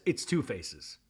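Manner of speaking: low, soft, calm imitation; imitated calm pronouncement, kept low. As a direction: state it calmly, low and quiet. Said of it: "Mary just calmly says".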